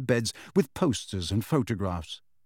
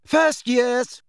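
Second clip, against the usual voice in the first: high, loud commanding call; 10 semitones higher, 4 dB louder than usual. A narrator's reading. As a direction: summon them with authority. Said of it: "Hermione called commandingly".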